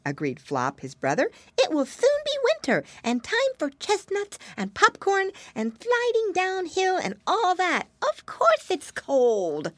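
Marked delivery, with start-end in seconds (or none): 1.6-9.7 put-on high, squeaky piggy boy; little pig voice. A narrator's reading. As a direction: play a chirpy little pig brother.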